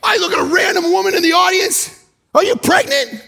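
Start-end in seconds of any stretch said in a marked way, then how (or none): 2.3-3.2 mock blurted question. Asking a random audience woman.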